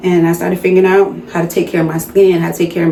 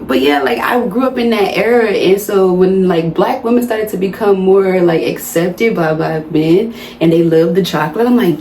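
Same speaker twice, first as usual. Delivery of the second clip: ordinary delivery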